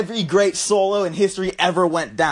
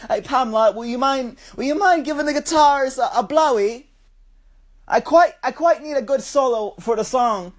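higher-pitched mock British accent; badly faked, polite British bloke; put-on British-Australian accent; 6 semitones higher than usual. Asking Pamela a favor for the guitarist, mock British accent. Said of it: "what's, like, a British girl's fucking name?".

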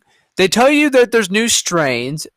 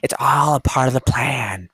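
Joker impression; 5 semitones lower than usual. Quoting the Joker's line about the plan, lightly voiced. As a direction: try on the Joker's menace lightly. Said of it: "the Joker say it?".

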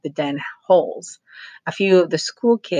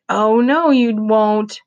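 higher, louder defiant outburst; shout; 7 semitones higher, 6 dB louder, slower than usual. A narrator's reading. Roy shouting defiance at Mother Paula, higher and louder. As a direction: blurt it out loud and defiant. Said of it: "came out of Roy's mouth as a shout".

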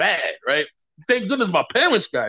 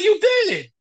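delighted praise; 11 semitones higher than usual. Retold or imitated. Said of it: "he said".

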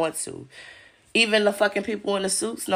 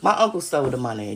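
ordinary talk, nothing marked

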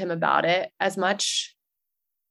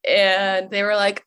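ordinary delivery

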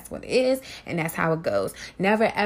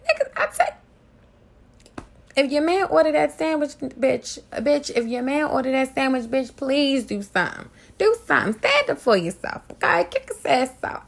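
rally the women to stand up for themselves; fired-up warning; 6 semitones higher than usual. Real, not acted. Warning women about cheating men.

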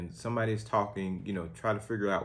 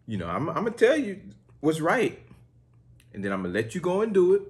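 higher, fast, firm mom lecture; lecturing mother; 9 semitones higher, 5 dB louder, faster than usual. Acted. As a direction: rattle it off like a mom laying down the law. Speaking to Brandy as mother.

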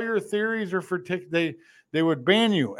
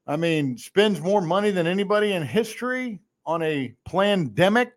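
usual speaking voice

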